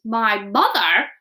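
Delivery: loud, slow astonished giant; cried out. A narrator's reading. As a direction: cry it out in surprise. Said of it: "cried the B F G".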